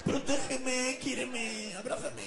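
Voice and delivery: high whiny panda voice. Acted. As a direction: plead like a clingy girly panda. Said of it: "hablas como mi novia". (panda con voz aguda de novia mimosa)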